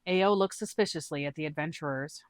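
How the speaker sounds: ordinary talk, nothing marked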